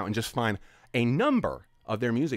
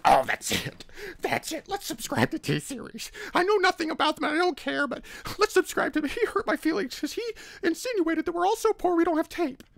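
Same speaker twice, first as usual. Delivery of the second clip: much higher, whining mock outrage; whiny mock offended fans. Mocking offended Romanians who switched to T-Series, high whiny voice. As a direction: play them as wounded crybabies. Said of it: "Did all Romanians like".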